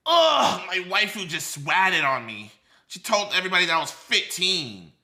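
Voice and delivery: loud groaning complaint; a loud hammed-up moaning caller; whiny caller. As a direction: whine it loudly like a sulky caller.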